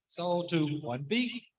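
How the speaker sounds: auction caller voice; auctioneer. Acted. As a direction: call out bids like an auctioneer. Runs a charity auction.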